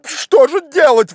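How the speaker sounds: very high, loud lament; desperate, high-pitched exclamation; despairing cry. A narrator's reading. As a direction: cry out at your wits' end. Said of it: "как видно, в отчаянии".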